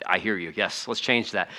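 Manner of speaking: a mild humbled yes; meek agreement. Retold or imitated. Preacher voicing his own reply.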